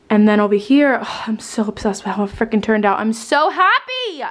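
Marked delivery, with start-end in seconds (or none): 3.0-4.2 high, slow delighted squeal; excited. Real, not acted. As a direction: gush with real delight. Delighted with her decor.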